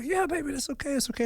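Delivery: high, softened, tearful reassurance; retold holding back tears. Father comforting his daughter through held-back tears, high and soft. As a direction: soothe a child while trying not to cry. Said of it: "I'm, like, trying not to".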